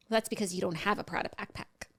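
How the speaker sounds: hushed whisper under the conversation; whispered aside. Pretend. Co-host slips in a joke about not having one, whispered. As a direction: slip it in under your breath.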